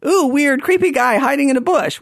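high-pitched mock unease; creeped-out reaction. Hikers first reacting to the stranger in the bush, mock creeped out. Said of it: "our initial reaction was".

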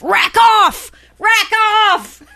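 loud, high Aussie yell; shouted insult. Shown as a demonstration. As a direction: holler it as a telling-off. Demonstrating Aussie insult, yelling.

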